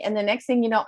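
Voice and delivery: usual speaking voice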